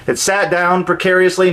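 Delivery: ordinary delivery, nothing marked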